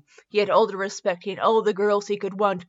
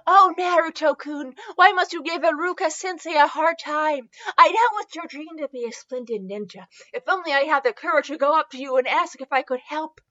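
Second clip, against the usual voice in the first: put-on higher, wistful girlish voice; shy admiring girl; 4 semitones higher than usual. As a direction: think aloud as a timid admirer. Voices shy girl's thoughts.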